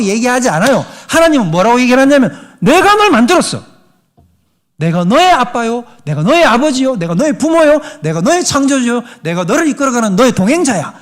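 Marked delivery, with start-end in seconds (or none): 2.6-10.9 high, loud declaration; proclaiming. Pretend. God declaring to his children, loud proclamation. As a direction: proclaim it with full authority. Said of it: "라고 선포할 뿐이라는".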